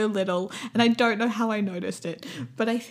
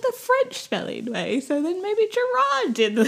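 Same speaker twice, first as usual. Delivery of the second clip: excited theory; 13 semitones higher, 4 dB louder than usual. Retold or imitated. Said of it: "I was like".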